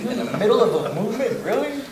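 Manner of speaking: high-pitched, mock-incredulous question; acted incredulity. Submariner seeing hikers wave mid-movement, acted incredulity. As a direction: squeak up in disbelief on "really". His disbelief at waving hikers.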